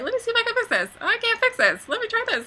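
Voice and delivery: high, light, upbeat muttering; cheery fixer voice. Retold or imitated. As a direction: act chirpy while fixing it. Acting cheerful fixing audio.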